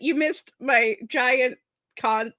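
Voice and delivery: usual speaking voice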